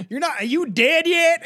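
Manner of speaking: shouted, high mock killer taunt; mock killer yell. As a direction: yell it like a brute.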